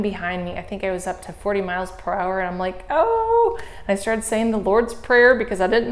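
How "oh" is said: startled gasp. Retold or imitated. Scared on fast windy descent.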